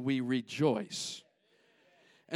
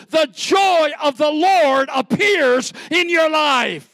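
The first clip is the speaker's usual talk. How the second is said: loud, much higher, slowed pulpit shout; preaching at full force; 9 semitones higher, 10 dB louder than usual. Acted. Pastor urging the church to choose joy, shouting.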